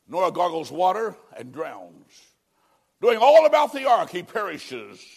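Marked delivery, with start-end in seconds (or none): 3.0-4.1 loud and much higher, a burst; a sudden preaching cry; preaching shout. Real, not acted. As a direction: belt it out to hammer the point. Preacher driving home the point.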